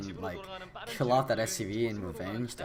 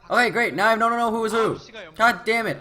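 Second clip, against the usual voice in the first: loud, exasperated cursing; frustrated outburst; 8 semitones higher, 6 dB louder than usual. Real, not acted. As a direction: blurt it out in annoyance. Colors switched, caster frustrated.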